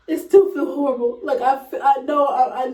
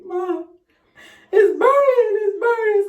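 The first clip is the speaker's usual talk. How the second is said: pained cry; 8 semitones higher, 5 dB louder than usual. Retold or imitated. Begging mom during birth.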